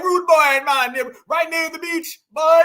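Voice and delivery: put-on high, loud parody of a Jamaican accent; mock Jamaican accent. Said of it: "talking in a Jamaican accent again".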